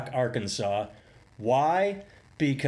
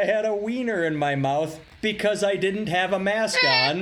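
high, whiny fake sobbing; mock tearful apology; 7 semitones higher, 3 dB louder than usual.

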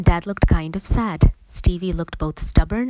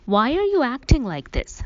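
higher, pressing frustrated question; frustrated demand; 8 semitones higher than usual. A narrator's reading. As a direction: push back at her, exasperated. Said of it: "I demanded in a frustrated tone".

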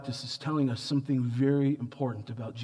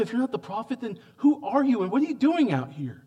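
usual speaking voice

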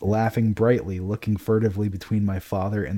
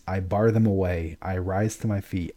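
ordinary delivery

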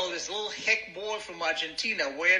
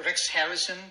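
ordinary delivery

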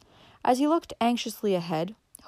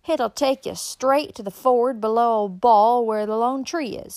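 higher, loud country voice; backwoods woman; 3 semitones higher, 5 dB louder than usual. A narrator's reading. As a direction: holler directions like a hill woman. Backwoods woman gives directions.

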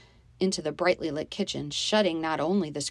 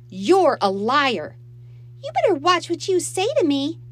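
loud, high furious retort; angry outburst; 11 semitones higher, 9 dB louder than usual. A narrator's reading. Stepsisters trading threats in a quarrel, angrily. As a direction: start loud, climb higher in warning.

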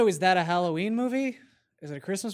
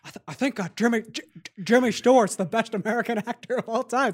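ordinary talk

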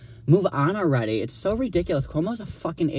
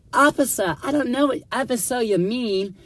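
mock innocent; 9 semitones higher than usual. Playing dumb to the cop.

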